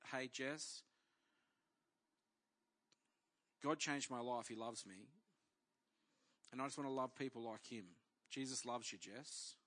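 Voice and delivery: quiet, tender reassurance told to the church; tender retelling. Retold or imitated. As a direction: gently recall words of comfort to a hurting woman. Retelling gentle words to Jess.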